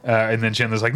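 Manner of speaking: ordinary talk, nothing marked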